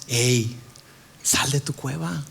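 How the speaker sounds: low, soft invitation; gentle coaxing. Acted. God calling you out of your cave, gentle and low. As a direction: coax them out quietly.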